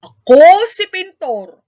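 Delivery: loud, slow, proud announcement; painter introducing himself. A narrator's reading. Painter announcing himself to the villagers, loud and slow. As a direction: boom out the name slowly and proudly. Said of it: "sabi ng lalaki".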